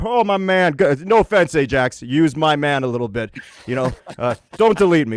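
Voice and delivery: ordinary talk, nothing marked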